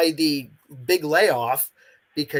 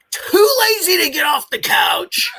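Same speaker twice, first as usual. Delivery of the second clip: high, loud teasing exclamation; mock exasperated outburst; 8 dB louder than usual. Teasing lazy players on the couch, a loud mock outburst. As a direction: throw the line up high as a playful jab.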